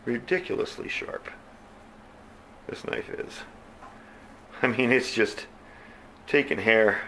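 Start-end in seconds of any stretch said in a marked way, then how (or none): 0.1-3.4 whispered in awe; breathy whisper of admiration; hushed aside. Real, not acted. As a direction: drop to a whisper to marvel at the edge. Awed aside about the blade.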